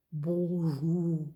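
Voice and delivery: low, soft, drowsy creature grunt; a big snorer mumbling half asleep; low drowsy puppet hello; sleepy creature. Acted. Sleepy snoring creature Onimus greets.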